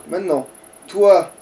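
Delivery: ordinary delivery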